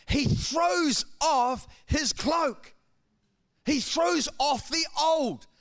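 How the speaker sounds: slow, weighty, each word leaned on; preacher slowing down for the key point; slow emphatic preaching. Acted. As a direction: land the key point slowly. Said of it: "slow emphatic sermon point".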